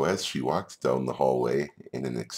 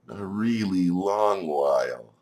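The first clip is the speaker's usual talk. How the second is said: slow, higher, stretched-out words; drawn-out emphasis; 5 semitones higher, 4 dB louder, slower than usual. Acted. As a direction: drag it out to mimic a long wait. Comic stretching of the long wait.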